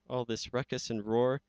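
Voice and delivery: plain reading voice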